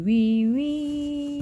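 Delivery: high squealing wee of a little pig; piggy squeal. Acted. Mother reading the rhyme, squealing like the piggy. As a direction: squeal it like a piglet running home. Piglet crying wee all the way home.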